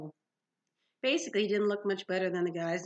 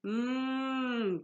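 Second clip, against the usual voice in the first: drawn-out higher-pitched cow moan; lowing; 5 semitones higher than usual. Acted. Bull serpent lows at talk of sacrifice, lowing. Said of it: "Bessie lowed".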